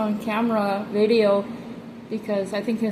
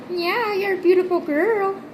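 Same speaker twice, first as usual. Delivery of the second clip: higher sing-song coo to her pet; pet talk; 9 semitones higher than usual. Real, not acted. Owner cooing at her pet lizard, higher sing-song praise.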